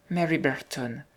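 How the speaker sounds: mildly breathless, softened answer; out of breath. A narrator's reading. Mary running behind the boy, mildly out of breath. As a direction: answer as if a little winded. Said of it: "répondit-elle tout essoufflée".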